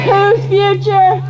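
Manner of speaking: slow, high shouted chant call; shouted rally call. Real, not acted. Organizer shouting to the rally crowd, high slow chant. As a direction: stretch it high to lead the crowd.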